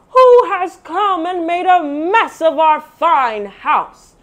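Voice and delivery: huge, slow, high bellowing roar. A narrator's reading. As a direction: roar it out like an angry bear. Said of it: "Papa Bear let out a roar".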